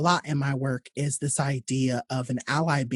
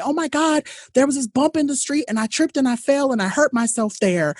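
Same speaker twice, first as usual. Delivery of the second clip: put-on hurt child; 9 semitones higher than usual. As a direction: play a small kid complaining about a fall. Voicing the hurt child again.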